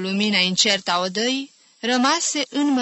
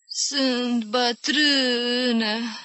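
put-on very slow, higher old-woman voice; dreamlike; 4 semitones higher, much slower than usual. A narrator's reading. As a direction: draw every word out as if dreaming. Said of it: "zise ca în vis Iaca".

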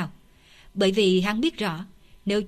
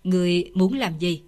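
usual speaking voice

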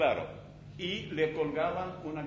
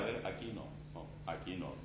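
cautious muttering; 8 semitones lower, 12 dB quieter than usual. Retold or imitated. Picking steps in a minefield.

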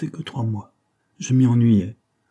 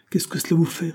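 indignant retort; 8 semitones higher than usual. A narrator's reading. Acted retort to a prying servant.

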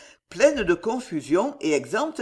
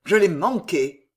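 louder, mildly growled admission; growled frustration; 5 dB louder than usual. A narrator's reading. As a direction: growl it out, cursing the miss. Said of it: "gronda Maurevert". (dépit grondé avec force)

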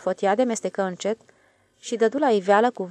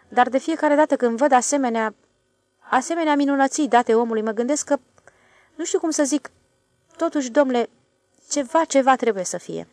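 lightly raised, louder voice of a self-assured stationmaster; a boss pressing his point; stationmaster's voice; 6 semitones higher, 7 dB louder than usual. A narrator's reading. The stationmaster quizzes the switchman, lightly voiced.

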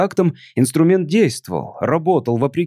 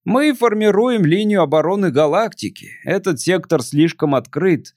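put-on a melancholy drunk visitor; tipsy alien voice; 6 semitones higher than usual.

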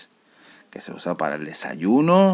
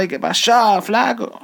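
high, loud, hearty hello to a buddy; cheery shout across the street; Canarian greeting; 13 semitones higher, 5 dB louder, slower than usual. Retold or imitated. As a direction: greet a mate whose name you forgot. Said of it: "una forma de saludo".

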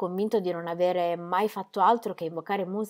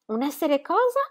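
higher, rising puzzled echo; puzzled question; 9 semitones higher, 4 dB louder than usual. Acted. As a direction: echo the odd word, baffled. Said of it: "domandò Ingeborg". (domanda stupita, più acuta)

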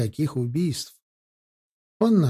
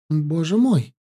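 gasping; 2 semitones higher than usual. A narrator's reading. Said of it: "ахнула Вероника".

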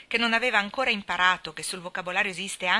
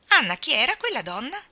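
calling out; 7 semitones higher, 7 dB louder than usual. A narrator's reading. Marilla calls to Anna.

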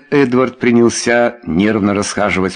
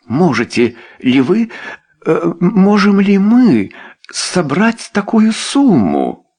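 high, slow, strained question; falter, then push it out, strained; choked voice; 10 semitones higher, slower than usual. A narrator's reading. Wife asking about raising the money, choked. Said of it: "спросила сдавленным голосом".

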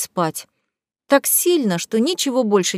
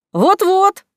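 high, loud, eager agreement; gleeful agreement; 10 semitones higher, 5 dB louder than usual. A narrator's reading. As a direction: chime in gleefully. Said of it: "поддакнул радостно племянник". (радостно поддакивая)

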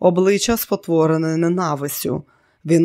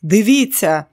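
louder, higher cry of alarm; sudden frightened shout; panicked yell; 4 semitones higher, 5 dB louder than usual. A narrator's reading. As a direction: bawl it out in panic. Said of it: "у паніці заволав".

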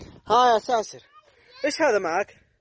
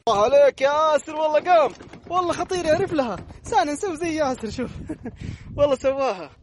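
excited cheering; 10 semitones higher, 5 dB louder than usual. Real, not acted. Cheering son on slide.

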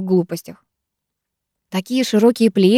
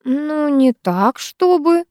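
higher-pitched, slow hesitation; hedging, trailing reply; faltering; 8 semitones higher, much slower than usual. A narrator's reading. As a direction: stall awkwardly, drawing the words out. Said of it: "замялась Диана".

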